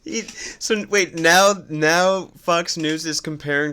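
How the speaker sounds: can't believe what he's hearing; stunned, rising outrage; incredulous. Real, not acted. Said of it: "That is so crazy".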